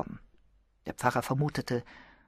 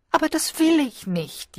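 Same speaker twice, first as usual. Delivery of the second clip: high, petulant whine; high childish protest; whining; 12 semitones higher, 3 dB louder than usual. A narrator's reading. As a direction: wail it like a spoiled girl. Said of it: "jammerte Jane".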